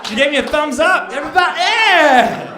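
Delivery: high, loud cheer to the crowd; excited shout. Real, not acted. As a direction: shout it to whip up the whole room. Whipping up the crowd after cheer.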